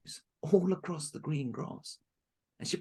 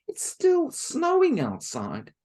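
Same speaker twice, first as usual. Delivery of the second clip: high, slow, louder plea; dismayed girl; 11 semitones higher, 9 dB louder, slower than usual. Acted. Marushka pleading with stepmother, high and slow. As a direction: plead that it is snowing.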